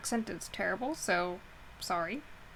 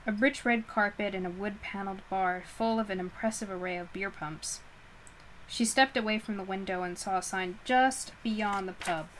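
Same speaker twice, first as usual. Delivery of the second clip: plain reading voice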